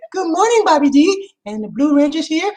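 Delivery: high, bright-up excited read of a fan message; high cheerful listener greeting; bubbly greeting read out. Retold or imitated. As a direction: sing the greeting high and happy. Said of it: "She said".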